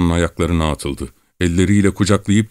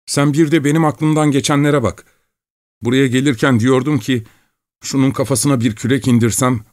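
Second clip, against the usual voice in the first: higher, feverish babbling confession; babbling young peasant; 8 semitones higher than usual. A narrator's reading. Young peasant blurts out his murder plan, higher feverish babble. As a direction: spill the dark thoughts in a giddy rush.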